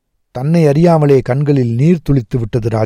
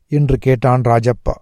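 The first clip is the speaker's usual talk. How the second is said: usual speaking voice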